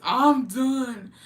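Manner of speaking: high, loud exclamation; drawn-out loud wail of amusement; amused outburst. Real, not acted. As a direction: cry it out, amused and overcome. Amused outburst at viewer's comment.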